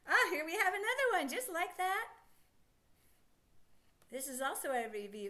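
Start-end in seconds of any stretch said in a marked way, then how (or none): none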